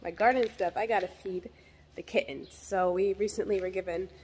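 ordinary talk

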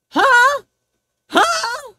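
sharp high loud huh; loud disbelief. Real, not acted. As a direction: blurt it out in disbelief. Shocked at the phrase.

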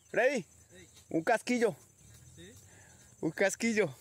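high-pitched call announcing a find. Real, not acted. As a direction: call the news over to your partner. Calls find to distant companion.

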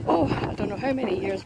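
usual speaking voice

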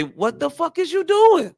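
high, loud call-out; exasperated challenge. Acted. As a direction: demand it in disbelief. Acts out calling someone out.

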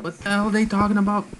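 lightly voiced baffled viewer, high and fast; a hint of a confused audience, laughing; mock viewer. Imitating confused viewers while laughing, lightly. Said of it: "People are like".

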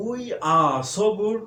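slow, high "We are so good" with are reduced to "আ"; teacher drilling the contraction slowly; we're as "আ". Shown as a demonstration. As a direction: drag out "We are so good", are a short "আ". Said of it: "আ".

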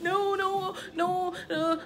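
high wailing string of refusals, acted; hammed-up high-pitched pleading; panicked old-lady fussing. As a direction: mimic clinging on in dread. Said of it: "acting like an old lady".

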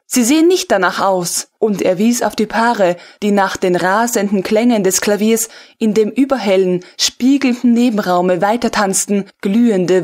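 ordinary delivery

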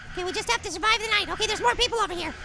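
ordinary talk, nothing marked

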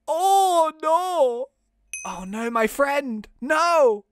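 high, loud melodramatic lament; hammed-up grief, drawn out; mock anguish. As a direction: wail over a fallen friend. Mourns the killed friend.

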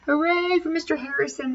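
high cheering hooray; cheering teachers. A narrator's reading. Teachers cheer the janitor, high and excited. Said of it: "they yelled".

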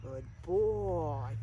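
low, soft praise to the puppy; soft dog praise. Real, not acted. Trainer softly praising his puppy, soft and low. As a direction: coo it gently to the dog.

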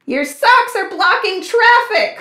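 storybook neighbors calling out in protest; hollering. A narrator's reading. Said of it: "hollered the neighbors".

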